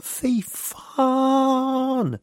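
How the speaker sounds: slow, high, stretched fy fan; drawn-out outraged curse. Real, not acted. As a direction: drag out the curse in disbelief. Mock outrage at towel-grabbing Swedes.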